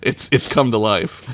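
usual speaking voice

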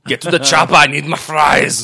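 hammed-up movie line about fries; mock Arnold shout. Arnold impression demanding fries.

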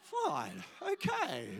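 high laughing fine okay; amused mock resigned. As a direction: play along with the boy, amused. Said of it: "I said".

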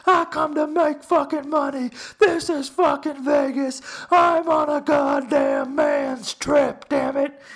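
loud, high wrestler's boast; full-force bragging yell; WWE promo. Retold or imitated. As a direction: shout it like a wrestling promo, stretching the words. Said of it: "I cut a fucking W W E promo".

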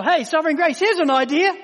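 light, high, offhand mock suggestion; breezy mock offhand tone; mock casual suggestion. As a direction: toss it off like a casual idea.

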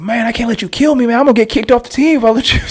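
high, fast voiced teammate, lightly mocking; teammate's excuse. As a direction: teammate explaining why he blocked the shot. Teammate's reply retold.

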